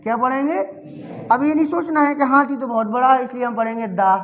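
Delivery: usual speaking voice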